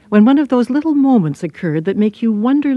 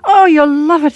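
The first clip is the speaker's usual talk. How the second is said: high, louder Irish voice, full of delight; delighted Irish gush; 8 semitones higher, 6 dB louder than usual. Acted. Check-in girl enthuses about Ireland, delighted Irish voice. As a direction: beam with delight in an Irish lilt. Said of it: "her face beamed delight".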